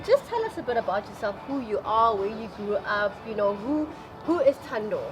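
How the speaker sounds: ordinary talk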